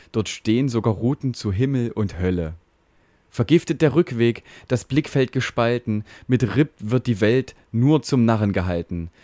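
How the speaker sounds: measured, rhythmic poem reading with a light lilt; mock-solemn ballad reading; ballad recitation. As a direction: recite it like a Goethe ballad, tongue in cheek.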